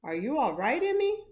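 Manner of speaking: put-on boy calling to his friend. A narrator's reading. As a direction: call to her across the crowd. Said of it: "He called out".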